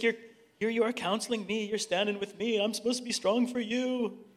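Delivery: a touch of the counselor's tears, voice lifting; mild imitation of a crying man pleading. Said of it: "He's crying".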